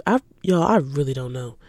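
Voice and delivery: ordinary talk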